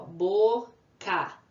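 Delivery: Boca split as a long Bo, then ca; syllable by syllable. Shown as a demonstration. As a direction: pause between Bo and ca. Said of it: "Duas sílabas".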